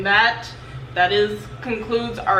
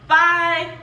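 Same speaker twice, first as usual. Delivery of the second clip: high bye; 8 semitones higher than usual. Real, not acted. Cheery sign-off to viewers.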